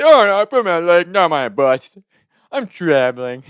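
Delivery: very loud put-on character blurting; hammed-up loud, high start; cartoon prank voice. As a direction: shout it like a cartoon prank caller. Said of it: "Jimmy character from South Park voice".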